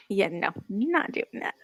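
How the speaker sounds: normal talking voice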